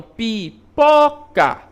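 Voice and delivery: "pipoca" slowly split, with a loud push on "po"; stress on middle syllable. Shown as a demonstration. Teacher asking pupils for the strongest syllable, "pipoca" slow with "po" stressed. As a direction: break "pipoca" into syllables and hit "po". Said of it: "com maior intensidade".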